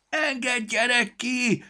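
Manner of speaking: high, loud, slow squeaky creature shout; shouted plea. A narrator's reading. Storybook in the sack yelling to be let out, high and loud. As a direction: yell to be let out, tiny and shrill. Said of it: "kiabált a kalandregény".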